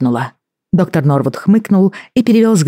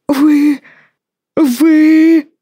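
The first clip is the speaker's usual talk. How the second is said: high, sputtering, lost for words; choking on outrage; speechless indignation; 13 semitones higher, 4 dB louder than usual. Acted. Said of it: "не мог подобрать подходящих слов, чтобы выразить негодование".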